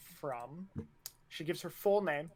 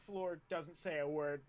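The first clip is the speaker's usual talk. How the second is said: usual speaking voice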